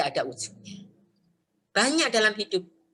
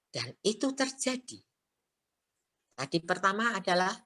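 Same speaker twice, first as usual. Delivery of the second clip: ordinary talk, nothing marked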